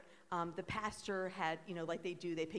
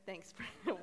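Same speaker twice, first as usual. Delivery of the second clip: usual speaking voice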